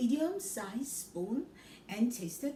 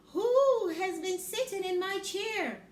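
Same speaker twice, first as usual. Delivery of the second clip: higher, louder mother bear voice; medium Mother Bear; 8 semitones higher, 6 dB louder than usual. Acted. Mother Bear asks about porridge, higher and louder. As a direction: ask it like a middling mother bear. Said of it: "she asked in a medium-sized voice".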